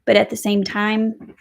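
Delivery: ordinary talk, nothing marked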